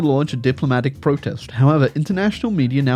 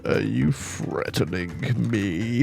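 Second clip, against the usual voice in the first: mock menace; 5 semitones lower, 7 dB quieter, slower than usual. Mock menace at French message.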